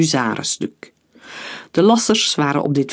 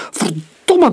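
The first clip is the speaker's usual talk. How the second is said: higher, louder furious roar; a foreman losing his temper, yelling; roaring curse; 8 semitones higher, 4 dB louder than usual. A narrator's reading. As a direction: bellow it at the crane crew. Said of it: "brulde hij".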